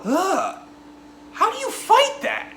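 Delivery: high-pitched horrified outburst; mock horror. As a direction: react aghast, voice shooting way up. Acting a viewer's horrified reaction.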